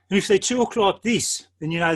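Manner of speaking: Birmingham accent. Shown as a demonstration. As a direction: drop into a broad Birmingham accent.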